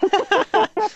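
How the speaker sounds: high, bright laughter. Real, not acted. Touched by the wish.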